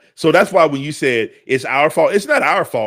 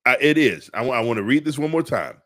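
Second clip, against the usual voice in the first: normal talking voice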